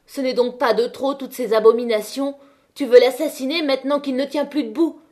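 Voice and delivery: loud, high outraged protest; indignant shouting. Acted. Catherine shouts at Étienne defending Chaval, outraged. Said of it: "lui cria sous le nez".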